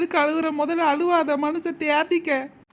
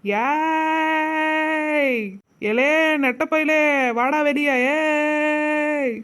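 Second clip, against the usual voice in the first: long drawn-out "ey" hollers; calling out; 2 semitones higher, much slower than usual. Acted. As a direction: holler for someone to come out. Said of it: "என்னமா சொல்லி கூப்பிடுவோம்".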